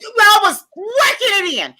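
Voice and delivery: high and loud, slowed for show; excited boast. Real, not acted.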